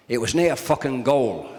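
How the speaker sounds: slow, mock Scottish accent from a grumpy ground worker. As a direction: drag out a Scottish accent, deadpan and blunt. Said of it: "apologise to you lads north of the border about the accent".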